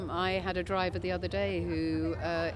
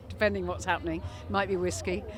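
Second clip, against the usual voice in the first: ordinary talk, nothing marked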